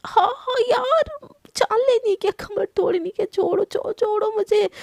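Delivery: high, slow crying wail of pain. Acted. Husband cries out high as wife presses his sore back.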